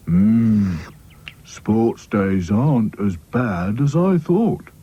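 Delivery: slow, contented Sad Sack voice; Sad Sack musing. Acted. Sad Sack enjoying the refreshments, slow musing. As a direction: mull it over slowly, mouth full. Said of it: "thought Sad Sack".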